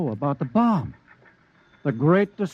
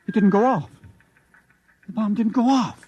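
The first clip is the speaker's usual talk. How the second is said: shocked disbelief; 7 semitones higher than usual. Acted. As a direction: blurt out the failure. Scientist shocked the bomb failed.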